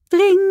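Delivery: high, loud imitated doorbell tring; doorbell ring. A narrator's reading. Narrator breaking in with the doorbell, high ringing tring. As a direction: ring out like a bell. Doorbell rings mid-sentence.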